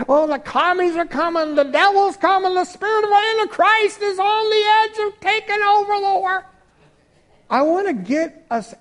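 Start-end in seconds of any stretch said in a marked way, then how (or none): 0.1-6.4 high, panicky mock alarm; mocking alarmist. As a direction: parody a fearmonger. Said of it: "political views and your fight".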